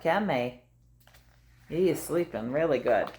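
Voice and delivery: ordinary delivery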